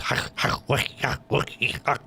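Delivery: breathless mock German babble; mock German gibberish. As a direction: rattle off fake German sounds. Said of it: "Germans sounding like this".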